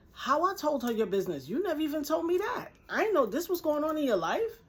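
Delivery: high-pitched, acted-out indignant denial; indignant protest. Friend defends herself in retold quarrel.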